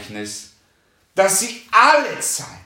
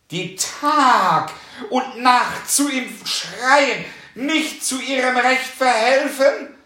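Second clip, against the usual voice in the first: slow, loud, emphatic preaching; preaching at full force; 2 semitones higher, 4 dB louder, slower than usual. Acted. Preacher to the congregation, slow and loud. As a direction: drive each word home to the flock.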